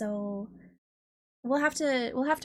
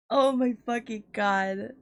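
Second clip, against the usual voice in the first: usual speaking voice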